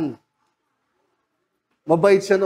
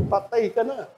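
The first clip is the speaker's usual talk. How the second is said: ordinary talk, nothing marked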